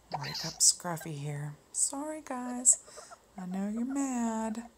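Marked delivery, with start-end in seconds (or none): 1.7-4.7 sorry-sounding and slowed right down; apologetic. Real, not acted. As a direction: coax the upset toys gently. Apologizing to the upset plush toys.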